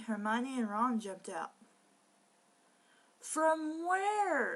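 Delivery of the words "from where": incredulous question. Real, not acted. Baffled reader interjection.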